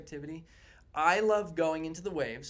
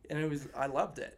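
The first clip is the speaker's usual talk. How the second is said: normal talking voice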